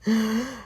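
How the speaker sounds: sudden excited gasp; sharp intake of breath on recognition. Real, not acted. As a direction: gasp of happy surprise.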